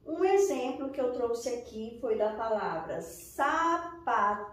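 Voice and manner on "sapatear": "sapatear" slowly split into separate syllables, open final AR. Shown as a demonstration. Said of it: "o som sai mais aberto".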